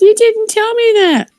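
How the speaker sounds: put-on high, indignant child's voice; daughter's protest. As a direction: protest like a kid. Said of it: "She goes".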